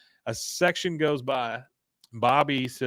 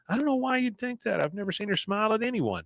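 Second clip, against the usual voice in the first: put-on high, boyish son voice, clearly pitched up; Bobby voice; 10 semitones higher, 4 dB quieter than usual. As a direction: play the young son, pitched well above your own voice. Bobby voiced in a retold scene.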